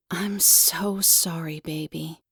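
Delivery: slow, soft, fading to a hush; tender comfort. Acted. Mother comforts her crying child, slow and soft. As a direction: hold the crying child close with it.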